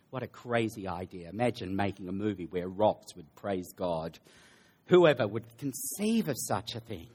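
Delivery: low, soft mock amazement; dry irony, kept low and understated; a little sarcastic. Preacher pretends rocks praising God is absurd, lightly sarcastic. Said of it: "Again, I'm being a little sarcastic".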